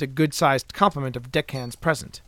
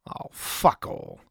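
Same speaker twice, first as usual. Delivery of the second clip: slow, voiceless curse; drawn-out, breathy expletive; muttered swearing; 3 semitones lower, 4 dB quieter, much slower than usual. A narrator's reading. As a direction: swear slowly under your breath. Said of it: "Colin swore".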